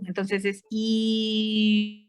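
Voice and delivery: drawn-out I closing toward U; I gliding toward U. Shown as a demonstration. Teacher demonstrating a slow "I" closing into U. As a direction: slowly round the lips on "I" until it becomes U. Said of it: "empezamos a cerrar la boca hasta hacerla como la U".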